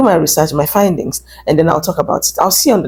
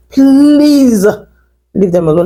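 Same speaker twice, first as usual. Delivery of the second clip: a raised, pleading please; loud plea; 3 semitones higher, 4 dB louder, slower than usual. Real, not acted. Begging viewers to leave them alone.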